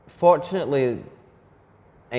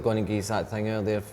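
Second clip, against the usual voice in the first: broad Scots, quick and run together; fast, slurred-together Scottish vernacular. Shown as a demonstration. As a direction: rattle it off in thick Scottish dialect. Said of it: "very, very quickly".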